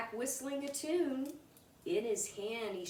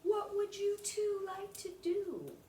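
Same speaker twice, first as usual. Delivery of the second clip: put-on higher, cheerful question to the kids; lightly voiced dad; 11 semitones higher than usual. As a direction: invite the kids to play. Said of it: "Jane's dad asked".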